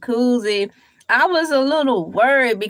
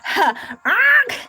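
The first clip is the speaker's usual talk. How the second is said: ordinary talk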